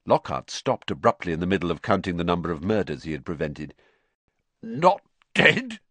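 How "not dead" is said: high, choked disbelief; strangled, grief-struck squeak. A narrator's reading. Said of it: "choked Filch".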